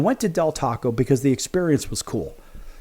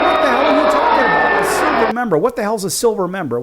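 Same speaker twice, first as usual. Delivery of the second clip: loud, higher, incredulous yelling; exasperated outburst; 9 semitones higher, 6 dB louder than usual. Retold or imitated. Retelling his blowup at the cookie shop clerk, loud angry disbelief. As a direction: blow up at the counter clerk in disbelief. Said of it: "Now, I'm losing my mind now".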